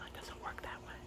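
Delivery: menacing whisper. Acted. Dad warning the trolls, cold whisper. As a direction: hiss it low and close, unvoiced. Whispered line before repeat.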